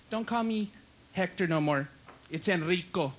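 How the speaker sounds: soft, low camp voice. Acted. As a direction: correct him softly with mock sultriness. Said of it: "Why are you talking like that?".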